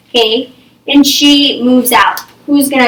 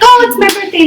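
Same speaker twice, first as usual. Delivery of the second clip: put-on excited kid; 10 semitones higher, 3 dB louder, faster than usual. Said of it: "They're like".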